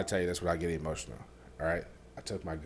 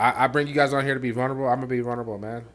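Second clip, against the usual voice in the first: louder and higher, fast, pushing through; loud insistence; 6 semitones higher, 9 dB louder, faster than usual. Real, not acted. Owning up to smoking, loud and insistent. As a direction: insist on it over their laughter. Insisting on honesty to laughing host.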